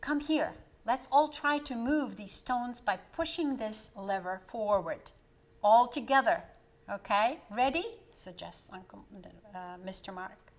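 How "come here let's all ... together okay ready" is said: louder, coaxing group call; rallying call. Acted. Mister Mark rallying the family to push the lever, loud and encouraging. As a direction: gather everyone to push together.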